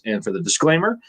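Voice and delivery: normal talking voice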